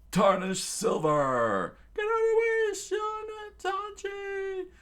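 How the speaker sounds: high, loud, slow stretched yell; hammy villain battle shout; villain attack cry. Acted. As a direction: bellow while diving down.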